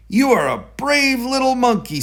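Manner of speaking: cheering the little hero on; proud director. A narrator's reading. Director praising George after the rescue, delighted.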